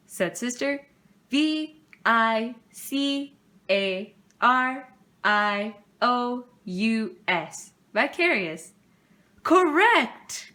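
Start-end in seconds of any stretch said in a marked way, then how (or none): 1.3-7.7 slow letter-by-letter spelling; spelled out slowly. Acted. Sister spelling the word onstage, slow careful letters. 9.4-10.5 high, loud delighted announcement; cried out. Acted. Principal announcing Sister's correct spelling, excited cry. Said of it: "cried Mister Honeycomb".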